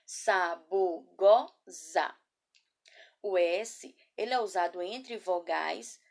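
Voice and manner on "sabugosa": Sabugosa slowly broken into four syllables. Shown as a demonstration. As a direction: sound out Sabugosa piece by piece for pupils. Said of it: "Vou falar a palavra novamente".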